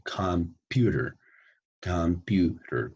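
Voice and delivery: "computer" slowly split into com-pu-ter; three-syllable breakdown. Shown as a demonstration. Teacher counts syllables for students, "computer" slowly broken up. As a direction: stretch "computer" into three clear beats. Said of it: "Tres sílabas, ¿ven?".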